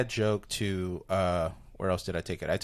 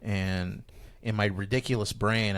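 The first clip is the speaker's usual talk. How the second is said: usual speaking voice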